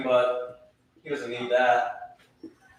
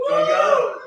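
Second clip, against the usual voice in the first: high, loud crowd-pumping yell; hype shout; 8 dB louder than usual. Real, not acted. New comic fires up the crowd with a high shout. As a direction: fire the room up.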